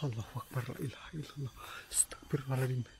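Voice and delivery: frightened breathy whisper; scared whisper. Real, not acted. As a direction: whisper prayers in dread. Whispered prayers in fear.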